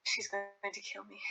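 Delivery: unvoiced whispered dread; worried whisper. [name] fearing his partner's anger, whispered. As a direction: whisper it like she'll find out.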